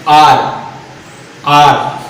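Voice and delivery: आर said low and drawn out as आ; pronunciation demo. Shown as a demonstration. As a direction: say आर clearly so students hear the आ. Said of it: "आर बोल के देखिए". (आर का उच्चारण करके दिखाना)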